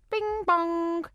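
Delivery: sing-song station chime; station announcement. Acted. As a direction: sound the tannoy chime, held level.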